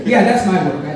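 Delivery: ordinary delivery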